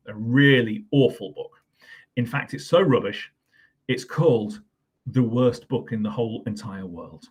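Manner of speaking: ordinary delivery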